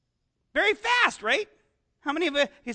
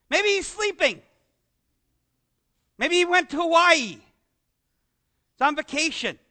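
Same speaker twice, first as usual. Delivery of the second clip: lightly raised mocking jibe; sarcastic taunt; 4 semitones higher, 4 dB louder than usual. Elijah mocking the priests about Baal, sarcastic jibe. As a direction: needle them with fake concern. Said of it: "He's taunting them".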